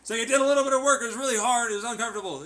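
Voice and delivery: loud rant. Real, not acted. Ranting at someone who claims they changed, loud scolding. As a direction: tear into them, loudly.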